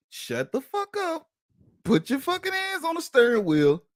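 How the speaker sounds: hammed-up version of his urgent order; high exaggerated order to his girlfriend; mock command. Replaying his order to her.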